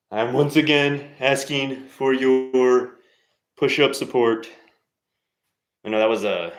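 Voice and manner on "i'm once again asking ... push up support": slow, higher and louder stab at Bernie that drifts toward Trump; Bernie Sanders attempt. Asking viewers for push-ups: slow failed Bernie impression. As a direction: go for Bernie's famous plea, slowly. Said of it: "I did a, uh, Donald Trump with that, but that was more of a Bernie Sanders thing".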